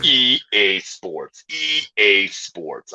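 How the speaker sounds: low, drawn-out game tagline; video game announcer. Shown as a demonstration. Comic showing off video game voice work, low slow announcer. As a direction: stretch each letter in a deep promo voice. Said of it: "video game".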